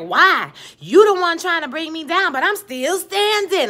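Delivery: high, loud defiance, building; defiant comeback. Retold or imitated. Recapper acts out Annalise standing up to her accuser, defiant. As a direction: throw it back high and stretch the last words.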